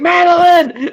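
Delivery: a sharp, high, loud call of her name; panicked call. Retold or imitated. Emmett shouting for his secretary, panicked. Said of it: "he's freaking out".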